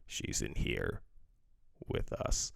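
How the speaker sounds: low breathy demon voice, only a hint; a touch of the creepy demon; lightly voiced demon. Reviewer imitating the demon in the movie, low whisper.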